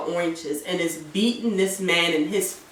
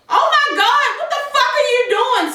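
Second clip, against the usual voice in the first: shocked outburst. Retold or imitated. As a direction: act out her scandalized disbelief, pitched way up. Acting out her shocked reply.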